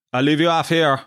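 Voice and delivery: put-on Dublin taxi driver; Dublin accent. Taxi driver dropping off his passenger, in a Dublin accent. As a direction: mimic a Dublin cabbie's accent. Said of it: "he's, he's Dublin accent".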